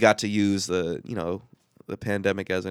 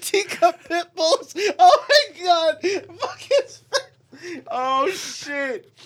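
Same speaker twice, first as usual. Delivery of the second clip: very high, slow laughing squeal; much slower than usual. Real, not acted. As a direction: squeak the words out while cracking up. Cracking up over teacup pit bulls.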